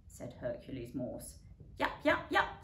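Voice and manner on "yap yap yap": high, quick yapping; small dog yap. A narrator's reading. Little dog Harry Maclary yaps back at the cat, high. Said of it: "said Harry Maclary".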